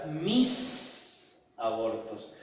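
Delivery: mis drawn out very slowly, set apart; stress on mis. Shown as a demonstration. Preacher singles out the words mis abortos, very slow and weighted. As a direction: linger heavily on mis abortos. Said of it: "me llamó la atención la palabra".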